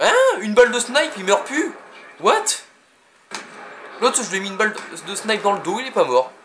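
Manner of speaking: high loud outraged disbelief; can't believe it, voice shooting up; shocked disbelief. Real, not acted. Enemy survives sniper shot.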